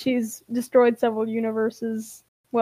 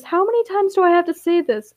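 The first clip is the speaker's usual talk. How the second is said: exasperated; 6 semitones higher than usual. A narrator's reading. Steele confronts Ash, exasperated.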